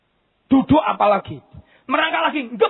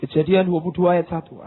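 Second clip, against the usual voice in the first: ordinary delivery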